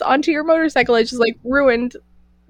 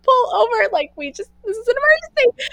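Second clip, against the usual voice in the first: higher, rushed alarm; urgent panic; 11 semitones higher, faster than usual. Retold or imitated. Girl with melting shoes begging her date to stop, high panic. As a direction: sound the alarm, pull over now.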